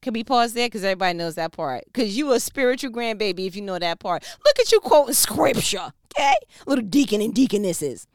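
loud, high teasing praise; loud playful cheer for the congregation; playful hype. Real, not acted.

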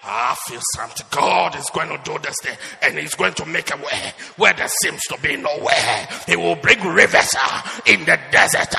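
loud churchy prophet voice; biblical voice. Retold or imitated. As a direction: intone it like a prophet. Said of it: "you start".

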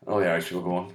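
ordinary talk

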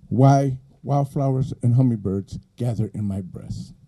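measured, declaimed poem with lingering phrase ends; poetry recitation. Acted. Poet reciting questions to an audience, measured poetry reading. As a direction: read each line out as a weighty question.

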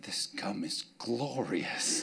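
slow, soft, blissful savoring. Retold or imitated. Pastor recalls gum on a fast, slow and blissful. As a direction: relish each word slowly. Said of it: "I was like".

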